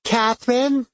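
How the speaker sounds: put-on high, childlike calling; little girl voice. A narrator's reading. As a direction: call out like a small girl. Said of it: "called in her little girl voice".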